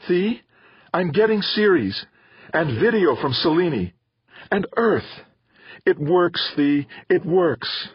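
high, elated mother's voice; excited. A narrator's reading. Mother telling Theo the antenna works, high and excited. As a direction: report it high and thrilled.

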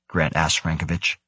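usual speaking voice

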